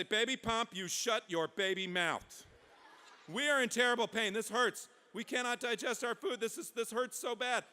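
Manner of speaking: high, loud mock-agonised complaint; pained scolding. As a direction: scold through comic agony. Sufferers scold laughing baby.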